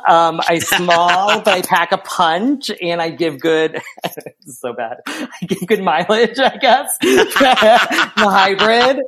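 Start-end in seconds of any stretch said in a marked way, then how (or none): 3.9-9.0 laughing at your own silly answer; real giggles breaking up the words; embarrassed amusement. Real, not acted.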